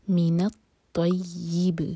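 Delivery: slow الطیب, the ی under kasra sounded clearly. Shown as a demonstration. Teacher models the kasra sound for the student slowly. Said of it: "تو make sure کہ یہ sound آئے".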